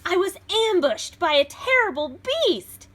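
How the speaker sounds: put-on lively read-aloud for children; storybook reading. A narrator's reading. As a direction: read it like a picture book to a class. Reads beast's version.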